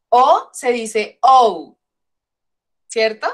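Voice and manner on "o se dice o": first O pure Spanish "oh", second O gliding "ou"; English vowel name. Shown as a demonstration. Teacher showing students English O, slow contrast of "oh" and "ou". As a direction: slowly contrast O "oh" with drawn-out O "ou". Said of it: "Pero en inglés no".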